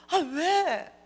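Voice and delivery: high, exasperated "what?"; acted-out huffy response; annoyed reply. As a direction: whine back in irritation. Annoyed reply to being called.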